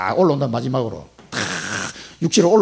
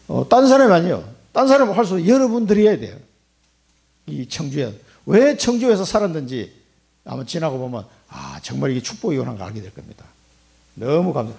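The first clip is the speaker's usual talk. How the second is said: usual speaking voice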